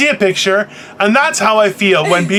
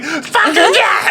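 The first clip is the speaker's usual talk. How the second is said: high, slow hammed-up yell; mock outburst; 11 semitones higher, slower than usual. Retelling a short woman's angry reply, hammed-up high yell. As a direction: imitate a tiny woman losing it. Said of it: "And she was like".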